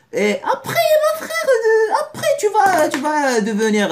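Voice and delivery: very high, loud mock cry-shout; hammed-up wail. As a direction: wail it like a fake sob. Mock wailing about the future.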